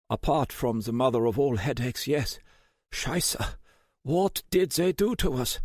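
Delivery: put-on high, pained German accent; groggy German hacker cursing. A narrator's reading.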